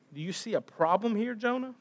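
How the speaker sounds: ordinary talk, nothing marked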